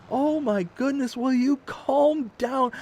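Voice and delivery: high-pitched, exasperated plea; high wifely scolding, stretched at the end. Retold or imitated. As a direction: imitate a fed-up wife, slowing at the end. Voicing exasperated wife in story.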